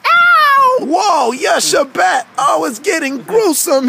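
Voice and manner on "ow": loud, high "ow"; hammed-up cry of pain; mock pain yelp. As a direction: yelp as a buzzed cartoon character. Puppet cries out when buzzed.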